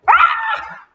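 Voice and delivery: piercing high shriek of fright; high scream. A narrator's reading. Wife feeling frog in bed, high scream. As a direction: shriek at something slimy. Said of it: "she screamed again".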